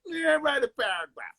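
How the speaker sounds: high-pitched, put-on mocking teacher voice; Mister Goodwin. Kid imitating his teacher on the playground, exaggerated voice. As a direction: mimic the teacher for playground laughs. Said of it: "I would imitate him later on the playground".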